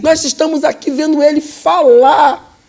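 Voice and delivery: loud, high, slow shouted news; excited shout. Retold or imitated. Doctor announcing the recovery to the director, shouting. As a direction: shout the miracle out.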